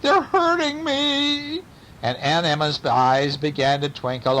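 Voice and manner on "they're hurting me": very high, slow whine; pained wail. A narrator's reading. Burglar complains as cats cling, pained wail. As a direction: stretch it into a storybook wail.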